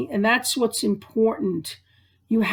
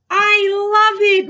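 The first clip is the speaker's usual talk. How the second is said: put-on much higher, louder delighted child; delighted squeal; 11 semitones higher, 6 dB louder than usual. Lukumi hugging her new toy lamb, delighted squeal. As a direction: squeal with joy over the gift. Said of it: "Lukumi said".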